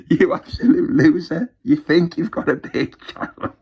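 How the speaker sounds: loud, high jeering; mocking loser taunt; laughing scorn. Real, not acted. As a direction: sneer while laughing.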